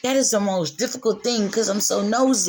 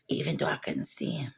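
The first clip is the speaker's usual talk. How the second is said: whisper; 9 dB quieter than usual. Real, not acted. Intimate confession about trusting God.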